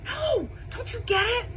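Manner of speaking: high, urgent exclamation; exasperated. A narrator's reading. Ivy corrects Bean's wrong guess, exasperated. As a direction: push it out high and insistent.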